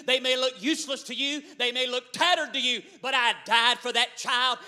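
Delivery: loud, commanding proclamation; voice of Jesus. Acted. Jesus defending his child against the devil, loud and commanding. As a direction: declare it from the throne with authority. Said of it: "Jesus steps off the throne and says".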